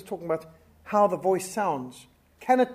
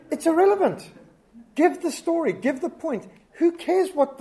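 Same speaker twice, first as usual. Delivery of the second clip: exasperated; 8 semitones higher, 4 dB louder than usual. Real, not acted. Fed up with irrelevant details.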